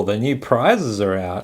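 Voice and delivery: normal talking voice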